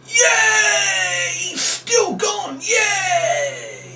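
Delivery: very high, hammed-up squeals of glee; squeaky mock bragging; gloating victory cry. Shown as a demonstration. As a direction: boast like the world's best magician. Fake magician gloating at kids.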